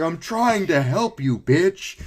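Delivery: high, loud and slow; exasperated shout. Retold or imitated. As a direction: drag it out in a furious yell. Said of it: "I was like".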